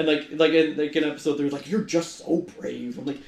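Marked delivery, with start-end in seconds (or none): 1.7-3.0 slow, drawn-out mock sincerity; lightly voiced sappy line, slow; dragging the cheesy line out slowly; mock quote. Quoting cringy episode dialogue.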